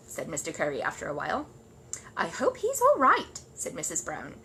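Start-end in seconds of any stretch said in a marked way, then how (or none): 2.2-3.4 high, slow worried mother. Acted. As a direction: fret gently about the bear. Mrs Brown worried.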